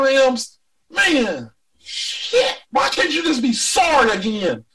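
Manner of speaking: high, heated rant; angry rant. Real, not acted. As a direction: vent it with real anger. Ranting at the Rams.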